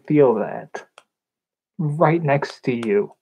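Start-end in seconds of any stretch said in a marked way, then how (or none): none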